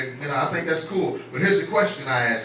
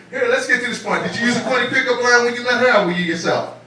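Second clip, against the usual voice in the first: normal talking voice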